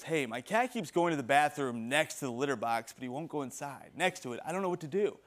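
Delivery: lightly voiced worried cat owner, trailing off; timid cat owner. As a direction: plead for help, quietly. Worried cat owner asks advice.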